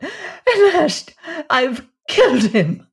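high, loud outcry; crying out. A narrator's reading. Woman sees Doomdorf lying dead, crying out. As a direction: cry out in a sudden high burst. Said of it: "Then she cried out".

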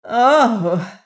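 high, loud anguished exclamation; crying out. A narrator's reading. Queen offered death by her freed stepson, crying out. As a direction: let it out as a wail. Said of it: "cried the queen".

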